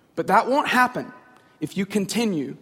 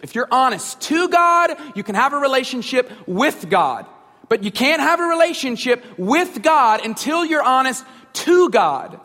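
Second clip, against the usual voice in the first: rising, louder preaching build; 4 semitones higher, 4 dB louder than usual. Acted. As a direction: drive each line harder like a pastor at the pulpit. Preaching climax on relationship with God.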